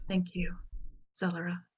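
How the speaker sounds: soft, hushed thanks; small, subdued voice; very quiet. Acted. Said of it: "very quietly says".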